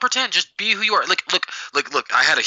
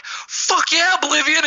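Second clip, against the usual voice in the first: excited cheer; 14 semitones higher, much slower than usual. Retold or imitated.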